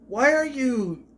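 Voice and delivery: high, drawn-out disbelief; mock-pleading exasperation; exasperated cringe. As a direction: stretch it out in pained bafflement. Cringing at the characters' dialogue.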